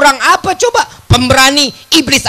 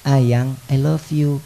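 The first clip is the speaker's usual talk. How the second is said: low, slow lover's murmur to his wife; husband's slow, low murmur of love to his wife; whispered sweet nothing; 10 semitones lower, 3 dB quieter, much slower than usual. Acted. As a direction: breathe it into her ear, very slowly.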